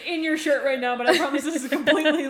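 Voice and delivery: loud, high line through laughter; hammed-up justification, laughing; laughing mock boss. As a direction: play the boss's excuse while cracking up. Jokingly voices the groping boss.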